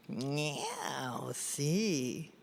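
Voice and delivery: low, very soft purring meow; soft, low sly cat meow; cat answering. Acted.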